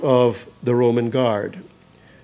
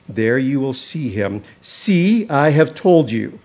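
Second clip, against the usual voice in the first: proclaimed; 2 semitones higher, 4 dB louder than usual. Acted. Preacher giving the angel's command to the women, proclaimed loud. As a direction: call it out like a herald's command.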